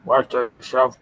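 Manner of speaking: mock gruff character voice; a goofy put-on villain voice; stupid voice. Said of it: "I'm doing this stupid voice".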